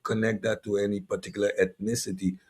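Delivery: normal talking voice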